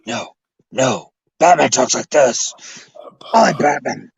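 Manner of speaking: low mock Batman; hammed-up Batman impression.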